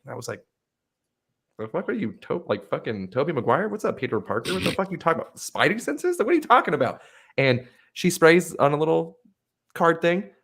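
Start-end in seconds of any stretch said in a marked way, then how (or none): 1.6-7.0 retold bafflement. Retold baffled reaction to saleswoman.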